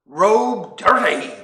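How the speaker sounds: slow, weary two-word grumble; terse monk. Acted. Monk grumbling to the head monk, slow and weary. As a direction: drag the words out like a fed-up monk.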